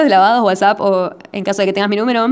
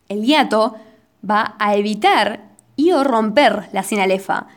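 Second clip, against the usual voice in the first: ordinary talk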